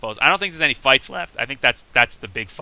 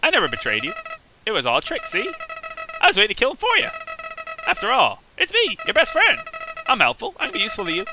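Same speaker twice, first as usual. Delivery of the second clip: much higher, sing-song sneaky flower; squeaky high false-friendly whine; Flowey voice; faster than usual. Acted. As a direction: wheedle like a treacherous little flower. Reading Flowey's lines in voice.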